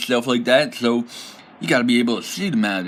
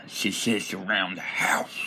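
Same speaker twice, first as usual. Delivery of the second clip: hushed, slower whisper of the punchline; 6 semitones lower, 7 dB quieter than usual. Shown as a demonstration. Goblin trash-talking, punchline whispered. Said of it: "joke repeated as whisper".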